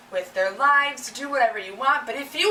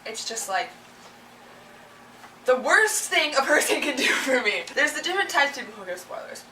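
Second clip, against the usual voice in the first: ordinary talk, nothing marked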